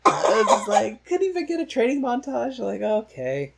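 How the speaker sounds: laughing. Real, not acted. Laughing repeat.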